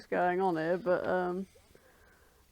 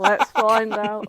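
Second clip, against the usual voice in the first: mock trailer voice; 8 dB louder, slower than usual. Dramatic trailer-style summary reading.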